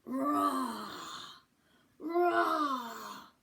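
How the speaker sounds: loud playful roar, rising to a shout; a hammed-up dinosaur call from the jungle. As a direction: roar like a big friendly dinosaur. Dinosaurs roaring from island.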